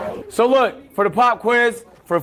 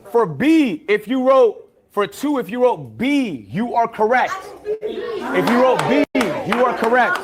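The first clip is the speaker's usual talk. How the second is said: slow, high, stretched quiz-master delivery; drawn-out answer reveal; 4 semitones higher, slower than usual. Pretend. Teacher revealing pop quiz answers to class, drawn out. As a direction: stretch out the key words for suspense.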